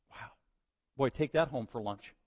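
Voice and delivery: normal talking voice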